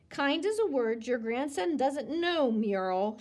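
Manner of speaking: ordinary delivery, nothing marked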